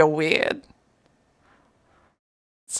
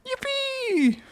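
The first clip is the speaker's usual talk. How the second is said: squeaky cheer; 6 dB quieter than usual. Pretend. Reading chat cheer.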